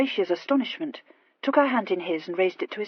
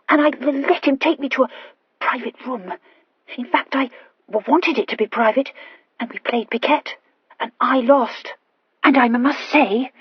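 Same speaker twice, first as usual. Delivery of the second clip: higher, louder, stumbling over consonants; stammering Horatia; 5 semitones higher, 8 dB louder than usual. Acted. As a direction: confess eagerly with a nervous stammer. Horatia confesses.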